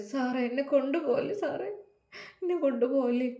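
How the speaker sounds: high, soft crying plea; tearful pleading. Acted. Arrested wife begging the police not to take her, crying softly. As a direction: sob out the begging, don't take me.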